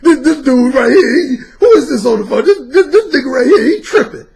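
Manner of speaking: husband frantic on the phone to his wife; hysterical husband. Retold or imitated. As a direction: lose it completely in disbelief.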